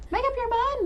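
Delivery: very high-pitched exasperation; exasperated outburst. Real, not acted. Player scolding a moody game character, exasperated outburst. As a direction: send it way up in pitch, fed up.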